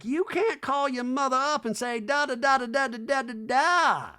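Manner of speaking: high chattering [name]; mock [name], high and lilting; hammed-up high [name] voice; [name] impression. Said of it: "[name]'s like".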